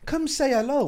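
high, lightly put-on come-here call; inviting staring fans. Telling fans who stare to come over, high mock-friendly call. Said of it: "I'm just like".

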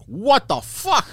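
high, drawn-out disbelief; retold shock. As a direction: stretch each word in hammed-up astonishment. Retelling shock on reaching the hotel.

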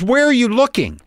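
high-pitched mock exasperation; exasperated question. Rental clerk questioning a customer who can't find the car, exasperated. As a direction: imitate a clerk losing patience, pitched well up.

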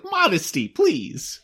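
high prim pleading voice; prim lady plea. Retold or imitated. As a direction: beg primly, voice up high. Voicing prim woman.

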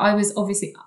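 ordinary delivery

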